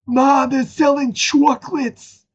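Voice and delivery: very high, slow squeaky cartoon voice; SpongeBob cartoon voice. Retelling the SpongeBob chocolate scene, high squeaky cartoon. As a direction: squeak it out slowly. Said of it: "SpongeBob episode".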